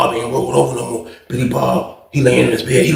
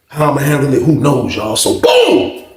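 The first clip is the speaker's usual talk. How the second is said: ordinary delivery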